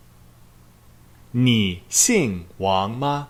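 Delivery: very slow Mandarin, "你姓王吗" with each tone given room; slow model question. Shown as a demonstration. 老师慢慢地示范问句. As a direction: say "你姓王吗" slowly for the class to copy. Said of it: "Number two".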